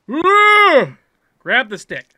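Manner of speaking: loud, high, dragged-out growling command; exasperated yell. Real, not acted. Player orders climber to grab the stick, exasperated yell. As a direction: stretch it out in frustration.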